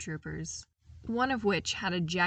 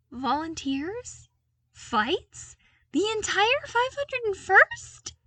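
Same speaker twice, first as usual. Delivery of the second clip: much higher, louder, slow incredulous squeak; 14 semitones higher, 8 dB louder, slower than usual. A narrator's reading. Luke to the clones, squeaking in disbelief. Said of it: "he squeaked out".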